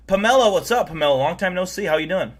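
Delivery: loud, high, cheery hello; excited greeting. Real, not acted. Greeting a new chatter.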